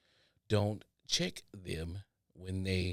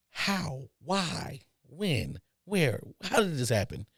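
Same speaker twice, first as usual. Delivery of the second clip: higher, rapid string of stunned questions; baffled questions; 7 semitones higher than usual. Real, not acted. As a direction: demand answers in disbelief.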